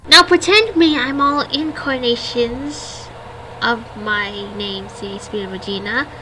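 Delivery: usual speaking voice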